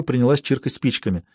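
ordinary delivery

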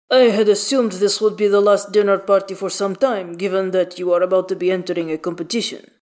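Halsin. A narrator's reading. Halsin messages Gale about the dinner party, weighty character voice. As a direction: speak firmly in a changed, even timbre.

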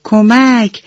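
restrained pretend yell from under a leaf; storybook cry out. A narrator's reading. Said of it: "فریاد زد".

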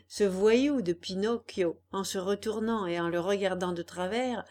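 ordinary delivery

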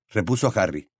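plain reading voice

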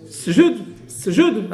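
"سجود" as sjud, s running straight into j; vowelless first letter. Shown as a demonstration. Teacher showing the original form, "سجود" begun on sukun. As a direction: start "سجود" on a hissed s with no vowel. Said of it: "هذا هو الأصل".

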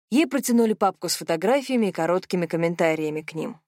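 ordinary delivery, nothing marked

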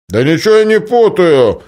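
loud, high protest; loud objection. Acted. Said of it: "возразил Башка".

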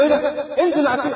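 mock a startled king ordering someone down. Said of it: "فمرستم اتخض". (مخضوض، بصوت أعلى)

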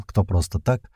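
plain reading voice

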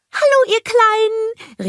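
high, slow, happy snowman call; cheery greeting. A narrator's reading. Olaf greeting the snowgies, high and happy. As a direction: call out warmly to the little ones. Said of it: "rief Olaf".

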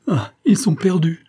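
high, loud lament; anguished high exclamation; despairing cry. A narrator's reading. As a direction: cry out as all is lost. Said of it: "dit la reine en tombant évanouie".

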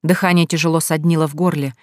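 ordinary delivery, nothing marked

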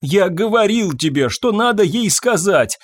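high, distraught plea; wailing. A narrator's reading. Husband begs wife, unable to bear it, wailing. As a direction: wail that it is unbearable. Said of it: "взвыл Фабьен".

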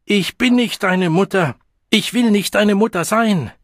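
high, loud angry denial; furious shouting. A narrator's reading. Mother rejecting the child she is hitting, shouting furiously. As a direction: hurl each sentence out in fury.

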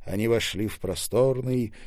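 usual speaking voice

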